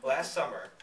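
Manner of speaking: ordinary delivery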